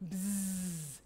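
soft, low sustained buzz; bee buzz. Shown as a demonstration. Teacher shows what sound a bee makes, soft buzz. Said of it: "sounds like a bee".

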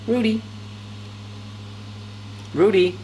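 ordinary talk

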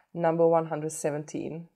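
usual speaking voice

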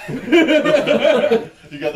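slow, laughing question. Real, not acted. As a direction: laugh through the words. Laughing at friend's bad bean.

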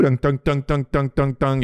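rapid repeated "dun" beeps; even, mechanical run of beeps; imitated off-hook signal. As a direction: mimic a phone's busy signal. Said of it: "and then, you know, it would go".

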